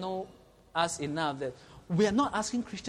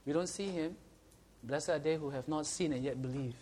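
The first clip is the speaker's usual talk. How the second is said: ordinary talk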